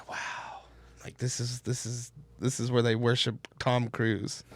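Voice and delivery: hushed, unvoiced whisper of disbelief; stoned awe, whispering in the lobby; awed whisper. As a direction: whisper it like you're in a church. Stoned, awed inside Scientology lobby.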